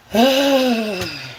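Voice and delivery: long, drawn-out sigh; real sigh of exasperation; exasperated sigh. Real, not acted. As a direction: let out a long exasperated breath. Exasperated pause in the rant.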